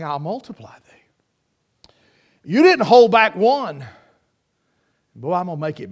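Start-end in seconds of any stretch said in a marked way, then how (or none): 0.0-0.8 hushed whisper, softer and lower; whispered divine promise. Acted. Dropped to whisper in quote.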